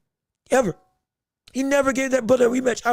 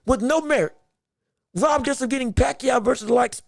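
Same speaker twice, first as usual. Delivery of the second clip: ordinary talk, nothing marked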